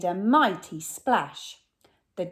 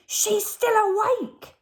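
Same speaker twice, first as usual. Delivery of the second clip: much higher, a fretful robber; robber Len; 4 dB louder than usual. Acted. Robber Len worries the mermaid is awake, high pitched. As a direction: squeak it out with a frown. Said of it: "said Len and frowned".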